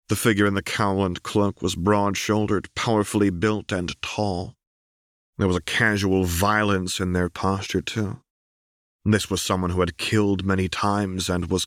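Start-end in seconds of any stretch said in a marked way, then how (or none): none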